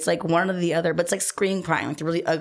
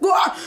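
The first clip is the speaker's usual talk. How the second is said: sudden loud high wail like a beast; gorilla roar; 12 semitones higher, 4 dB louder than usual. Pretend. Woman showing her inner gorilla, sudden roar. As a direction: burst out with a wild ape cry. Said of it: "at any moment".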